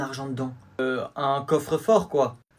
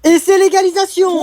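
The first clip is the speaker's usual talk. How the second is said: loud, higher sports commentator call; match commentary; 14 semitones higher, 11 dB louder than usual. Acted. Commentator calling the match to viewers, loud and high. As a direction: call the goal for the whole stadium.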